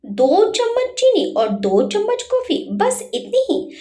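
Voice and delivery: high mocking echo of "दो चम्मच चीनी और दो चम्मच coffee", pitched well up; sarcastic mimicry. A narrator's reading. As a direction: throw her own words back at her with sarcasm. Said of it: "व्यंग करके बोला".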